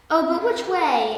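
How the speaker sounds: storybook dismay; sad. A narrator's reading. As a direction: ask it sadly, like a lost rabbit. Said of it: "asked Peter sadly".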